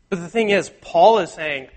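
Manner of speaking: normal talking voice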